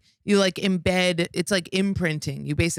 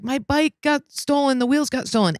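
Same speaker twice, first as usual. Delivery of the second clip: imitated crying; 9 semitones higher than usual. Imitating a girl phoning her ex about a stolen bike, mock crying. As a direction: mimic a tearful complaint in a raised pitch. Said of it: "she's crying and she's like".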